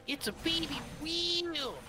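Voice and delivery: put-on high, slow, excited Boston accent. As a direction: yell it in a thick Boston accent. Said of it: "Boston accent-laced".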